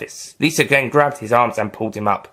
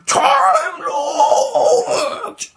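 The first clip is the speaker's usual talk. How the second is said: high, loud creature cry; creature shout; 4 dB louder than usual. Acted. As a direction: shriek the name like an alien. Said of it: "said a liquid Nestine".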